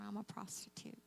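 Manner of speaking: soft whisper, almost no voice; whispered confession. Retold or imitated. Sobbing woman confessing to a stranger, whispered. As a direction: breathe the words out as a shameful secret.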